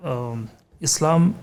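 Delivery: usual speaking voice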